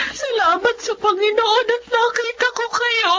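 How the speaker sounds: high, emotional thanksgiving; tearful thanks. Acted. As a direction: thank God through happy tears. Aunt thanks God at reunion.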